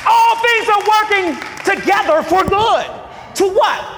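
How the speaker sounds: loud, shouted preaching to the congregation; full-force preaching. Acted. Pastor preaching to the church, shouting.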